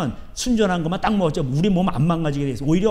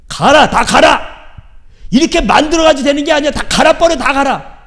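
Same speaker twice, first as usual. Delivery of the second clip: furious, much louder and higher command; angry divine shout; 8 semitones higher, 9 dB louder than usual. Acted. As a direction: thunder it out as an enraged God. Voicing God's angry command. (분노한 하나님의 고함)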